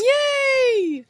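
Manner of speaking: high, thrilled squeal of joy; excited cheer. Real, not acted. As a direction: cheer it out, delighted. Cheering at the trip news.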